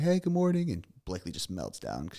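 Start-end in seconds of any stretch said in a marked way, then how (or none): 0.0-0.7 high, sing-song imitated morning greeting; retold wife greeting. Wife greeting her daughter while doing hair, high imitated voice. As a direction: chirp it higher, imitating someone. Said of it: "she's like".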